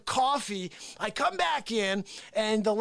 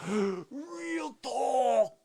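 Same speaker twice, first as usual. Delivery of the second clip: Face impression; 4 semitones higher than usual. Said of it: "And he goes".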